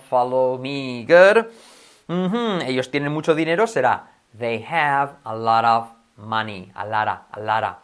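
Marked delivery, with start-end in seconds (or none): 4.4-6.7 "a lot" said as "a lara", T flapped to R. Shown as a demonstration. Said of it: "Fíjate, no a lot, sino a lara". 6.8-7.8 "a lot" said as "a lara", T flapped to R. Shown as a demonstration. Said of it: "sino a lara".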